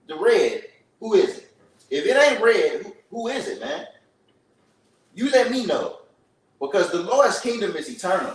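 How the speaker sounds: preaching. Acted. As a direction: hammer each question at the crowd. Street preacher questions the crowd.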